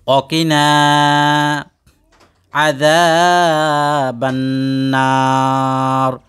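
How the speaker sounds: slow chant with every long vowel stretched; drawn-out Quranic recitation. Shown as a demonstration. Teacher shows students how to recite the dua, slow drawn-out chant. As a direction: intone it slowly, holding each madd. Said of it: "এখন আপনারা আমার সাথে বলেন".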